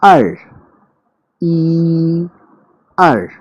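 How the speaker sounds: "ई" held high and level, "अर" dropped sharply and loud; Mandarin tones. Shown as a demonstration. Teacher demonstrating Mandarin counting tones for students to copy, crisp tones. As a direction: sing "ई" flat and high, then snap "अर" down hard. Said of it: "बार बार सुनिए".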